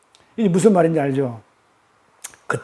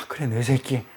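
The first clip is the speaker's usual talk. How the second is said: tender affection; 6 semitones lower, 8 dB quieter than usual. Acted. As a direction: lower and softer, loving and fond. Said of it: "사랑스럽게 봤다니까요". (사랑스럽게 그윽한 목소리로)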